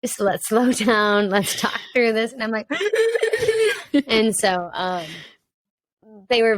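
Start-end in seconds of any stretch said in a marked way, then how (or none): 2.7-4.0 a sudden high laugh at herself; burst of laughter. Real, not acted. Guest laughing at her own sob story, high laugh.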